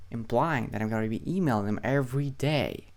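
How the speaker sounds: ordinary talk, nothing marked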